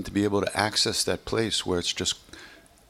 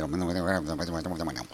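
anxious repeat that dies away to softer; fading nervous mutter; 6 semitones lower, 5 dB quieter, faster than usual. Retold or imitated. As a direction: let the worry trail off, much softer. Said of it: "these sensors get very nervous".

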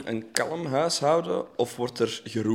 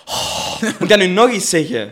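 exasperated sigh, then higher and louder; geërgerde zucht; 6 semitones higher, 10 dB louder than usual. Retold or imitated. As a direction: huff at having to repeat yourself. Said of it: "durf je die nog zo te zeggen". (geërgerd zuchten en luider herhalen)

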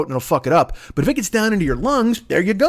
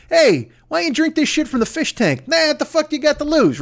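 higher, brash salesman pitch; mock Trump voice; 7 semitones higher than usual. Comic mocking the president's sales pitch, brash mock voice. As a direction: sell the poison like a pushy huckster.

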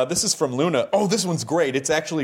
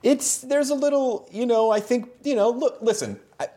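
mock evasive spokesman; 10 semitones higher than usual. Interviewer pretending to answer for the show, hammed-up evasive voice. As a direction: put on a pompous dodging voice.

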